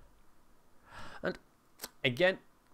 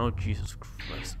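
startled whisper; 3 semitones lower, 8 dB quieter, slower than usual. Real, not acted. Startled by enemy appearing.

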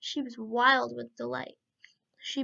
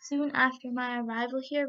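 plain reading voice

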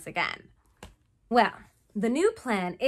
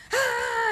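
mock sigh; 14 semitones higher, 3 dB louder than usual. [name] sorting buttons.

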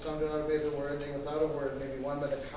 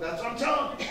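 higher, exasperated comeback; impatient insistence; 7 semitones higher than usual. Retold or imitated. Friend insists on meeting in blizzard, impatient. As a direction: imitate the friend insisting, pitched up.

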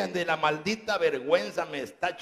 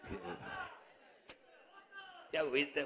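ordinary talk

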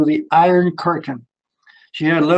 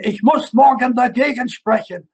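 higher, shot-out German declaration; sudden outburst; 7 semitones higher than usual. Retold or imitated. Shy boy suddenly answering the professor, high eager outburst. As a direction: blurt it out like a rocket. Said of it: "I came up like a rocket and I said".

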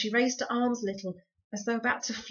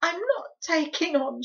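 high, gasped protest; gasping; 9 semitones higher, 7 dB louder than usual. A narrator's reading. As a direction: gasp it out through tears. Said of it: "she gasped".

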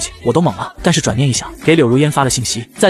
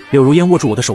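ordinary delivery

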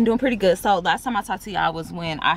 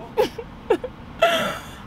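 high, breathless laugh. Real, not acted. Laughs after refusing.

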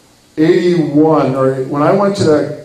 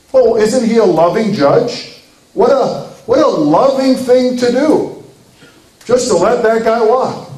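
higher-pitched, gushing ironic praise; mock admiration; 5 semitones higher than usual. Preacher mocking the judge who let the killer walk, sarcastically admiring. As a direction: coo over it as if it were wonderful.

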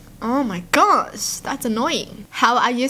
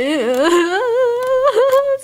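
long high-pitched laughing squeal; helpless high laugh; 13 semitones higher, 4 dB louder than usual. Real, not acted. Breaking up at a dog picture, helpless laughing wail. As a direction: laugh till it turns into a wail.

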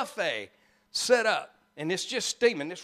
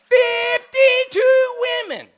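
very high, slow, full-voiced shout; louder unbroken yell to force a laugh; insistent punchline shout; 10 dB louder, slower than usual. Real, not acted. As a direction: shout it word by word, stretched out.

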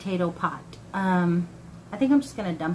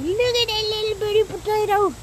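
excited; 12 semitones higher than usual. Real, not acted. Cooing over tiny potato.

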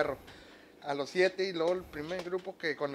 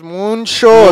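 much louder, higher, drawn-out ¡muchos!; Odessa way of saying it; 6 semitones higher, 23 dB louder than usual. Retold or imitated. Drummer imitating how people in Odessa say it, with a loud shout. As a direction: belt out ¡muchos! the way folks in Odessa say it. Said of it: "Como dicen allá en, en Odessa, Texas".

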